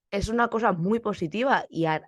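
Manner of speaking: ordinary delivery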